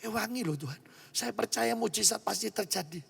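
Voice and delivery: soft, hushed plea to God; murmured private prayer; quiet prayer. Retold or imitated. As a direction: pray under your breath, pleading. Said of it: "Saya doa masuk kamar saya".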